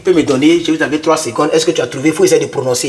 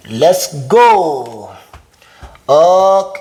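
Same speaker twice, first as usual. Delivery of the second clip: usual speaking voice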